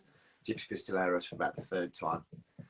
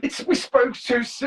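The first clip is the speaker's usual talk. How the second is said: mock shout; 14 semitones higher, 9 dB louder than usual. Real, not acted. Joking shout about drummer leaving.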